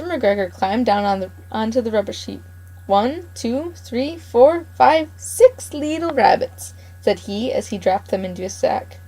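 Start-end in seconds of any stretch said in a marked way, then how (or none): 2.9-6.7 higher, slow, sing-song gloating count; old gardener counting his catch with glee; gloating farmer counting. Acted. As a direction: savour each number like a greedy old farmer. Mr McGregor counts rabbits in sack.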